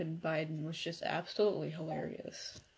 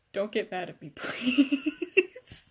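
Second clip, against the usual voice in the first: laughing plea; 3 semitones higher, 10 dB louder, much slower than usual. Real, not acted. Pleading with a laugh.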